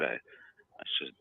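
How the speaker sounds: ordinary talk, nothing marked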